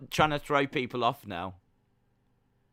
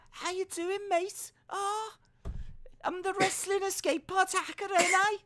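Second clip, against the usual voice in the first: very high, silly British voice; mock British hacker; 3 dB quieter than usual. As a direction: squeak out a hammed-up British accent.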